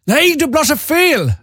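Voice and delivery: high, drawn-out scolding in mock German accent. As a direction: correct the patient as a shrill German nurse. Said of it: "hon pratade lite så här med tysk accent".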